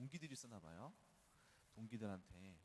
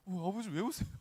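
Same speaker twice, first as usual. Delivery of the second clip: high, loud, amused startled question; surprised retold question; 10 semitones higher, 11 dB louder than usual. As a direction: blurt it out, mock-startled at Dad. Surprised child asking crying father.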